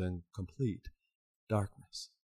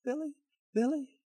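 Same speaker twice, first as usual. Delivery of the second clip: a higher, fading echo of the name; a far echo of the call; 14 semitones higher than usual. Acted. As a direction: let the name ring back from the rock. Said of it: "the echo of her own voice".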